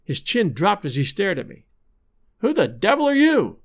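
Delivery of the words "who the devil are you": high-pitched, shocked demand; startled gasp. A narrator's reading. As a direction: narrator acting out a frightened gasp. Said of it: "he gasped".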